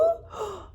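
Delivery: quick high-pitched surprised gasp. Acted. Lizard gasps at the tailless frog, high. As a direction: catch your breath in surprise.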